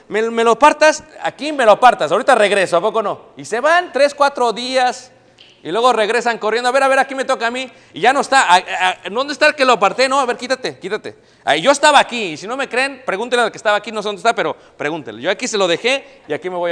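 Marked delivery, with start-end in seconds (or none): 0.1-3.2 loud, high pleading voice, asking to save his place; pleading line-saver. Retold or imitated. Acting out Latinos saving spots. 6.7-12.3 mock line quarrel. Acted quarrel returning to line.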